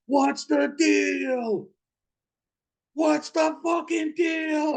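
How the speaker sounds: very high, slow mock shriek; drawn-out, high-pitched imitation scream; imitated screaming. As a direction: stretch each word into a high wail. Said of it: "didn't know why he's screaming".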